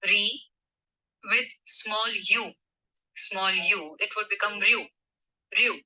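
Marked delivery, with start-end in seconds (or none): none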